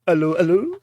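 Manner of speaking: normal talking voice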